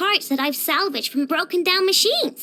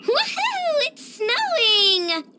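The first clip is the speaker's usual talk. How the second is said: high, drawn-out whoop of joy; gleeful cheer; 6 semitones higher, much slower than usual. Acted. Character seeing that it's snowing, gleeful cheer. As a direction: whoop and stretch it out in delight.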